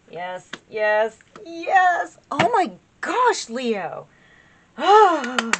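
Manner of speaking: high, loud burst of delight; thrilled, drawn-out exclamations; overjoyed excitement. Real, not acted. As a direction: cry out in real, happy astonishment. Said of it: "I'm just so excited".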